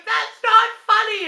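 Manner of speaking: high, loud indignant protest; scolding the invisible prankster. Retold or imitated. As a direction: protest loudly at the unseen prankster. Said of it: "I'll be like".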